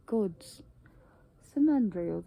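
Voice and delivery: normal talking voice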